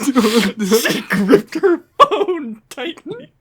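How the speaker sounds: high, slow, laughing through it; cracking up while reading. Acted. Hammed-up reread, laughing.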